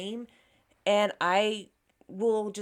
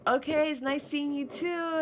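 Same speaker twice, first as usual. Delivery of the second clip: high-pitched mock-polite goodbye; polite brush-off; 8 semitones higher than usual. Imagined dismissal of the guy, put-on politeness. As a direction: act out an overly sweet dismissal. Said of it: "way too polite to dismiss somebody".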